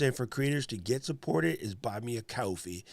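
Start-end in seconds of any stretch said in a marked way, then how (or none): 2.2-2.8 coffee in a New York accent; New York accent on the vowel in "coffee". Shown as a demonstration. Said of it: "say it with my New York accent".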